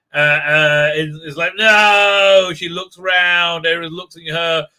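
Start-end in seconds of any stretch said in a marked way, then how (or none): 1.6-2.5 loud, high hammed-up refusal; mock dramatic cry. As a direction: shout it like a soap-opera hero.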